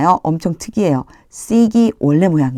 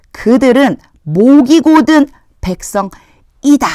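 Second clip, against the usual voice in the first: higher, louder, slowed wrath of God; angry God; 8 semitones higher, 6 dB louder than usual. Acted. God to Moses about his people, in anger. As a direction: thunder it out as the furious Lord. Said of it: "얼마나 하나님이 화가 나셨을까요".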